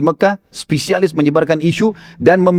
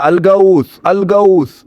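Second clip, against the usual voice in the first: seruan teriak tiruan; 3 semitones higher, much slower than usual. Retold or imitated. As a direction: call out like a town crier, held back. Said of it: "Dia teriak". (tiruan teriakan minta tolong)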